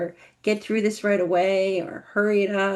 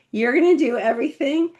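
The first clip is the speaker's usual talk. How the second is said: ordinary talk, nothing marked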